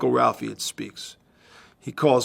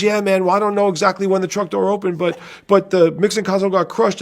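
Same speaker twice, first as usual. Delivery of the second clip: a higher, nervous road crew guy; driver voice, raised pitch; 8 semitones higher, 5 dB louder than usual. Retold or imitated. Driver on the phone explaining, higher pitched. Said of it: "And he says".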